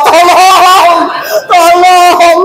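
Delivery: teriakan minta tolong pura-pura, tinggi; mock cry for help. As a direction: berseru tinggi dari dasar jurang. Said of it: "masih tolong-tolong di bawah". (istri minta tolong dari bawah jurang, teriakan tinggi)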